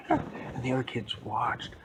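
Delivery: usual speaking voice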